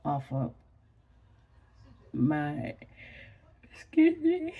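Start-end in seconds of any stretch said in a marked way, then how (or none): 3.7-4.5 high, loud words caught in a real yawn; talking through a yawn. Real, not acted. Sleepy vlogger excusing herself to viewers, yawning. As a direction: excuse yourself mid-yawn.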